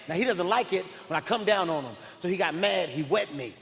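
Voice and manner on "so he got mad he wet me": ordinary talk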